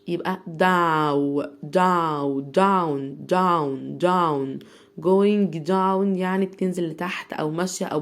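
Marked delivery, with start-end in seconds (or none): 0.1-4.6 down with the ow vowel, drawn out, dow then down; ow sound in down. Shown as a demonstration. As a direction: stretch the ow in down, then say down whole. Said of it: "هنطقها آو". (down بصوت آو ممدود)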